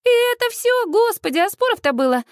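high-pitched scoffing disbelief; contemptuous and high; scornful. A narrator's reading. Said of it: "презрительно фыркает".

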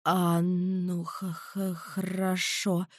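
nervous, stammering student. A narrator's reading. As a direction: stutter out of fear. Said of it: "запинаясь, пролепетал".

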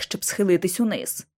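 ordinary delivery

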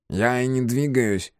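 slow, high, nasal reply; drawn-out reedy compliance; thin nasal voice. A narrator's reading. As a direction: protest meekly in a thin voice. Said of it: "Гнусаво и тоненько произнёс он".

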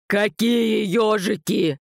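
slow, high-pitched outraged wail; incredulous wail. A narrator's reading. Vova is outraged about the hedgehogs, high drawn-out wail. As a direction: wail it slowly, pitch climbing. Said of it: "Вопль Вовы перешёл на ультразвук".

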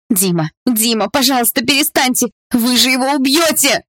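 high, pleading frightened cry; frightened scream. A narrator's reading. Girl begging the guards to stop beating Dima: frightened high scream. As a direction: beg them to stop, terrified. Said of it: "В страхе кричала Полина".

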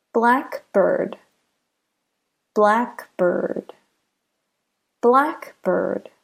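careful model of "blackbird", first part stressed. Shown as a demonstration. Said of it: "the stress is on the first part".

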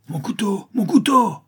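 very high, loud cry rising to a shriek; anguished cry. A narrator's reading. Bandit losing his knife, anguished cry. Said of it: "s'écria le brigand".

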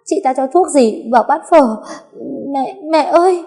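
gasping, high-pitched accusation; broken words through pain. A narrator's reading. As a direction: struggle to get each word out in agony. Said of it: "chữ được chữ mất".

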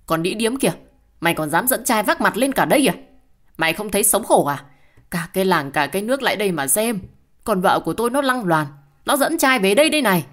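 loud, high, rushing shout that keeps climbing; furious husband bellowing at his ex-wife in the village office; yelling abuse. A narrator's reading. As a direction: scream the insults at her in front of everyone. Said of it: "đã nghe thấy mồm quát tháo".

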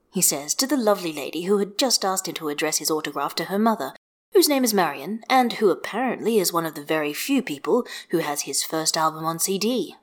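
usual speaking voice

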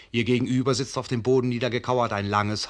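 usual speaking voice

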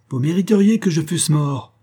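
high, loud indignant outburst; angry. A narrator's reading. Father scolding the suitor who fired at him, angry. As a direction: spit it out in wounded fury. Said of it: "dit-il enfin avec colère".

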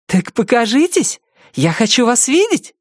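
high, eager, thrilled; excited girl voice. A narrator's reading. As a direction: call out with delight. Aza excited by ghosts.

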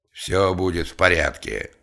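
firm, final assurance; understated boss's authority; brooking no objection. A narrator's reading. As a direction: reassure in a tone allowing no argument. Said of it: "не допускавшим никаких возражений тоном".

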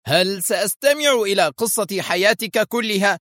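high, heated interruption; exasperated fury. A narrator's reading. Foster cutting off Dani's long report, exasperated. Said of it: "قاطعه فوستر في حنق".

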